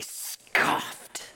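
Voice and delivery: SCOFFED, very stretched opening hiss; sound-effect word. Shown as a demonstration. Preacher repeats the onomatopoeia, SCOFFED as a drawn-out scoff. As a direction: stretch the s of SCOFFED, then burst. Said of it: "words that sounds like what it is".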